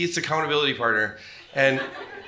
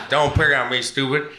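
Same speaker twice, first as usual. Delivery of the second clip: put-on louder, laughing "pick on me, stupid" in a Philly accent; 5 dB louder than usual. Imitating friend Keith's Philly accent, laughing and louder. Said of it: "And Keith's like".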